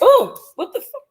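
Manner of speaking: sudden high startled cry with a laugh; startled yelp. Real, not acted. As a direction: jump at a touch and yelp. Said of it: "Something just touched me".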